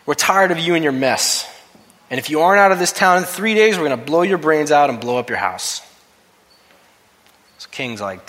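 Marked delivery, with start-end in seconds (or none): 0.1-5.8 louder menacing phone threat; threatening caller. Retold or imitated. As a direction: spit the threat like an anonymous caller. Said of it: "This is what the phone call said".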